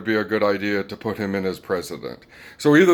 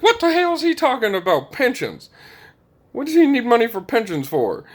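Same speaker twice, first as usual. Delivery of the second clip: much higher, louder mock disbelief; hammed-up outraged question; incredulous Americans; 12 semitones higher, 3 dB louder than usual. Americans reacting to pension request, mock incredulous. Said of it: "And we're like".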